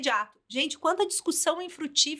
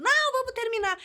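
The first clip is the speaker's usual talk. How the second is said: much higher mock-insistent wife, cutting him off; imitating the insistent wife; 11 semitones higher than usual. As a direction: play the wife who won't let the fight end. Wife insists on finishing the argument.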